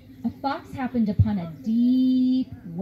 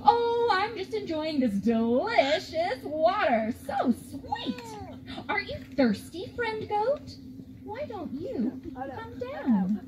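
crafty fox; 6 semitones higher, 3 dB quieter, slower than usual. Acted. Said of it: "The crafty fox called up".

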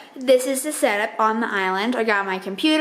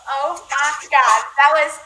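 exasperated excitement; 6 semitones higher, 4 dB louder than usual. Real, not acted. Co-writer after a messy live stream, loud and exasperated. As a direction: let the chaos spill out loud.

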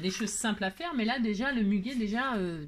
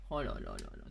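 deep gourmand groan; 8 dB quieter, faster than usual. Real, not acted. Delighted by cookies.

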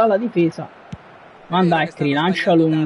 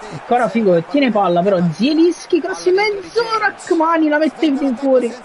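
excited commentary; 10 semitones higher than usual. Real, not acted. Gamer commentating attack.

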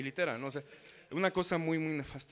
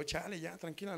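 softer, meekly calming her; meek plea; 3 semitones higher, 8 dB quieter than usual. Retold or imitated. He tries to calm the shouting girlfriend, meekly and softer. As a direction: back off softly.

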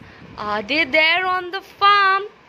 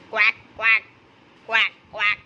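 duck quacks; 6 semitones lower than usual. Shown as a demonstration.

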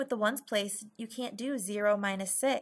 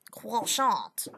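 emphatic mock-French accent; 4 dB louder than usual. Shown as a demonstration. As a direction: hit the last syllable in a put-on French accent. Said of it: "Sounds French".

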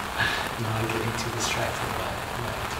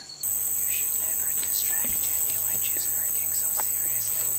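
hushed documentary whisper; 12 semitones higher, 7 dB quieter than usual. Pretend. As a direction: whisper so she won't hear.

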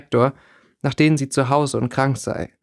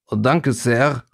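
put-on thick Durmstrang accent; older boy with heavy Durmstrang accent; slower than usual. A narrator's reading. Vasili acknowledging Regina's praise, Durmstrang accent. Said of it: "sagte der Durmstrang namens Vasili".

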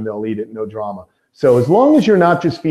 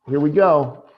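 usual speaking voice

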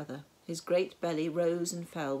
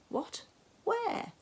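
sudden high surprised yelp; baffled, voice jumping up. A narrator's reading. Hans confused.

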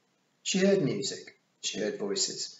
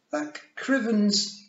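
put-on high, slow small-child voice; drawn-out, high toddler grumble; muttering little boy; 7 dB louder, much slower than usual. A narrator's reading. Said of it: "muttered Wentworth".

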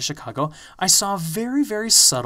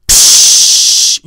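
loud hiss; 17 dB louder than usual. Retold or imitated. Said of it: "you know, really loud like that".

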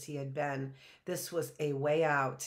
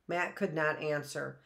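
ordinary delivery, nothing marked

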